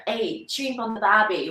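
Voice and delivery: demonstrated Australian twang; Australian accent. Shown as a demonstration. Giving an example of Australian talk, Australian accent.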